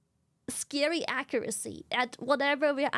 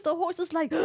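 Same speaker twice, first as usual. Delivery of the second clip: ordinary talk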